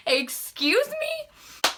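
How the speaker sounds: very high, slow, drawn-out question; squeaky mock-affronted query; acted incredulity. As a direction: stretch it out in offended disbelief. Acted incredulous reply to the captain.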